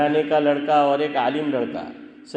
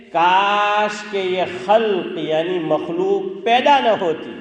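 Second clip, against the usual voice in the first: lightly voiced lament, higher and drawn out; slow, raised wish with a stretched first word; lamenting dove; 5 semitones higher, 5 dB louder, much slower than usual. Dove wishing creation had never been, lightly voiced lament. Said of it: "وہ فاختہ سعید بھائی یوں کہتی ہے".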